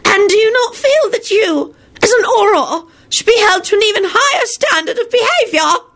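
very high, raspy crone snapping; triumphant old witch. A narrator's reading. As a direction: triumphantly pounce on the accused in a crone's voice. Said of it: "she snapped out triumphantly".

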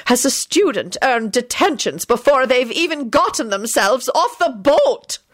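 stern, high-pitched pronouncement, drawn out at the end; deathly. A narrator's reading. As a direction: build to a damning final phrase. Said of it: "pronounced in a deathly tone".

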